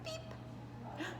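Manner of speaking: ordinary delivery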